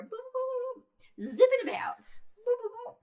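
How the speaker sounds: high playful boops for particles; boop sound effects. Retold or imitated. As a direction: chirp it like zipping particles. Imitating zipping protons.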